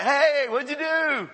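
high, loud and slow, calling across the field; coach calling out. Retold or imitated. Coach quizzing a player who ran the wrong way, loud and slow. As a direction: call it out like a patient coach on the field.

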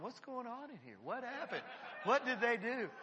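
high, bewildered mock boss voice asking what happened; surprised manager. Manager walking back into the room, high and amused. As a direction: play the puzzled manager walking back in, half laughing. Manager returns, finds staff hugging.

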